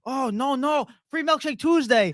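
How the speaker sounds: high-pitched mock non-native English accent; comic foreign-accent impression; imitated foreign accent. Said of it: "I'm gonna do the accent".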